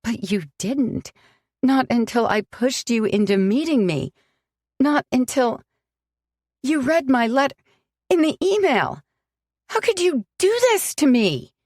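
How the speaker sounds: climbing from hurt to a high, loud cry; anguished outburst. Acted. Sam accusing Alex, rising to an anguished cry. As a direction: let it break upward by the last question.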